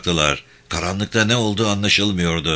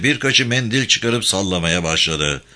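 usual speaking voice

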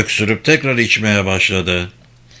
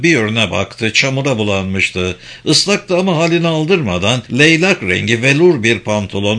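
usual speaking voice

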